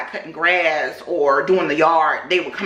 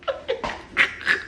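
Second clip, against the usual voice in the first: breathy laugh; 5 dB quieter than usual. Real, not acted. Laughing at own joke.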